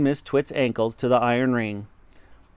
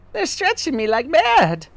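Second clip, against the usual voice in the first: wife crying out; 4 dB louder than usual. A narrator's reading. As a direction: wail it high as if being pulled. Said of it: "cried Miss Twit".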